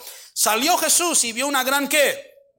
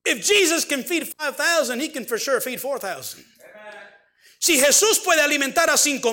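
impassioned preaching; 3 semitones higher than usual. Acted. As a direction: rise with each line to a cry. Preaching at full force.

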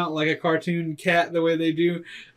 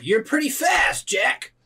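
big hearty voice; 8 semitones higher, slower than usual. Acted. GM voices Trent greeting.

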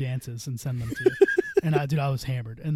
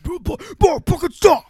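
very high, loud mock pleading to stop; high-pitched protest; 14 semitones higher, 8 dB louder than usual. Retelling his reply to a wakey-wakey, high loud mock protest. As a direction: squeal it out in exaggerated protest.

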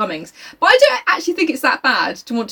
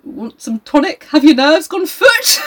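lightly voiced fussy doctor, slow at the end; hint of a patronising physician; mock doctor; 7 semitones higher, 4 dB louder than usual. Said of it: "he's like".

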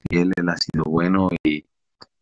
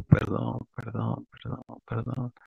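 higher, fading soft repetition; retold tearful apology; 4 semitones higher, 8 dB quieter than usual. Retelling how he wept in a friend's embrace, softly apologetic. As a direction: let each apology sink towards a whisper. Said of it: "yo seguía llorando".

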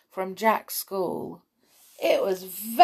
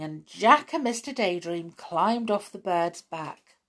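plain reading voice

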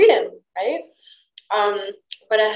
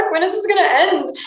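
high, louder, laughing fed-up groan; laughing exasperation; 9 semitones higher, 3 dB louder than usual. Retold or imitated. About being judged for pink, laughing exasperation. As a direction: laugh at the absurd judgment. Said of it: "I was like".

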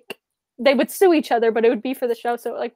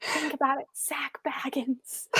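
hushed greedy whisper; a scheming mother whispering about money; 11 dB quieter, slower than usual. Retold or imitated. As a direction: breathe it like a secret deal. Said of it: "she's like".

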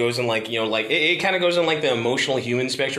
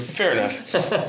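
usual speaking voice

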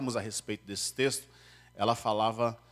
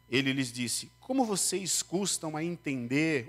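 higher, solemn Bible reading; scripture reading; 4 semitones higher than usual. Acted. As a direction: read the passage to the congregation. Reads the Bible passage aloud. (leitura bíblica solene)

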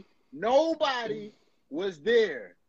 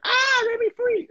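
high, loud mock cry of a chained-up woman; mock captive plea; 6 dB louder than usual. Man mocking a chained-up woman begging to be freed, high mock plea. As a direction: squeal it like a trapped victim begging.

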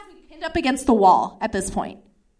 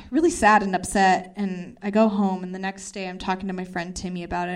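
usual speaking voice

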